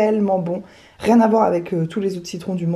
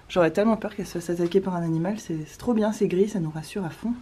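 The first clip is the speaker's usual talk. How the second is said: usual speaking voice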